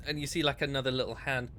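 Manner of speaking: usual speaking voice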